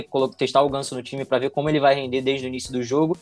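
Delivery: normal talking voice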